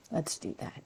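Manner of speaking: very soft unvoiced aside; whisper. Real, not acted. Teacher whispering an aside to viewers. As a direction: breathe it quietly.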